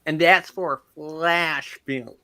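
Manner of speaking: very slow, drawn-out mock American accent; speaking slowly. Shown as a demonstration. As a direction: stretch each word as if for a slow listener. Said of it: "Sorry, I'll speak slowly".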